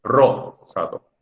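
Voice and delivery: "Rosado" said as RO, pause, sado; first-syllable stress. Shown as a demonstration. Teacher contrasting with the grave form, "Rosado" stressed on RO. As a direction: hit RO in "Rosado", then pause. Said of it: "también es grave".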